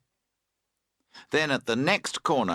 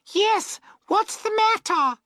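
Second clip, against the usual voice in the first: put-on very high girlish call; shouting back; 4 dB louder than usual. A narrator's reading. Girls towing to boatman, shouting back. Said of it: "they shout back".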